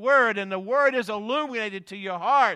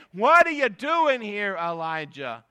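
What are you doing here, louder and higher; emphatic paraphrase; 3 semitones higher, 7 dB louder than usual. Acted. Preacher voicing God's challenge to Elijah, raised and emphatic. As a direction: press the question home with a raised voice. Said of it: "Twice God is saying".

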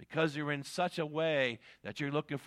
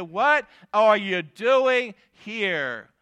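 slow emphatic question; 4 semitones higher, 8 dB louder, much slower than usual. Acted. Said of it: "He said".

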